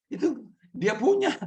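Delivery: normal talking voice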